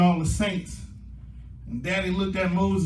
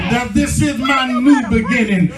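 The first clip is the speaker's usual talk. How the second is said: loud, raised-pitch sermon climax; full-force preaching; 4 semitones higher, 6 dB louder than usual. Real, not acted. Preacher rallying the saints to a new beginning, full-force preaching. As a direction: drive each phrase home, stretching the big words.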